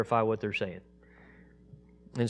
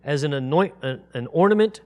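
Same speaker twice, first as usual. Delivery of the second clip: raised, louder measured Bible reading; scripture reading; 6 semitones higher, 6 dB louder than usual. Acted. Teacher reading Isaiah aloud to the congregation, solemn raised reading. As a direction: proclaim each verse solemnly, slowing on the names of God.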